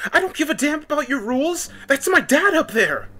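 high, loud, desperate scream; shrieking at full pitch; screamed back. A narrator's reading. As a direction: scream back in furious defiance. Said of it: "the younger girl screamed back".

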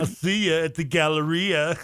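surfer dude. Acted. Friend joining the California accent joke, slow surfer dude. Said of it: "surfer dude, man".

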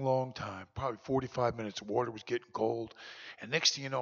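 ordinary delivery

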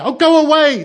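very high, loud panicked shout; calling out. A narrator's reading. Children to the new mother outside, shouting. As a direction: shriek it like a terrified child. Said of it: "they called out".

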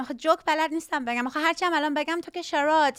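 rapid-fire Persian; very fast, running Persian patter; fast Farsi. Shown as a demonstration. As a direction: rattle it off in Farsi. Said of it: "you obviously spoke faster".

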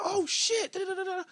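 very high-pitched, loud astonishment; amazed realization. Retold or imitated. Friend hearing it is that artist, amazed. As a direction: imitate a friend suddenly blown away.

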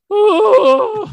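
loud, very high "ooh"; childhood milk-drop wail. Retold or imitated. Comic re-enacting dropping milk as a kid, high mock wail. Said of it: "most traumatic memory I have".

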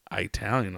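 "Italian" said as EYE-tal-yun; inflection on I. Shown as a demonstration. Showing the odd pronunciation heard, "Italian" starting with a long EYE. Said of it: "with the inflection on the I".

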